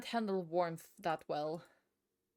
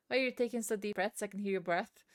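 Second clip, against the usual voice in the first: ordinary delivery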